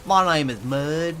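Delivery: drawl. Shown as a demonstration. Repeating his name to chat in a slow drawl. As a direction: lean into the lazy drawl. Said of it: "as much of a drawl as I could get".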